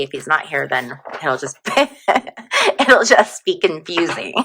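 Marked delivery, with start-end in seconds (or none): 1.6-2.8 short high burst of giggling; high giggle. Real, not acted. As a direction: giggle helplessly. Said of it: "I'm so giggly".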